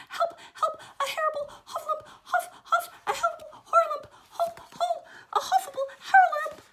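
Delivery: very high, loud panicked squeals; Piglet, still crying out. Acted. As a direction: shriek in tumbling, muddled panic. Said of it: "still crying".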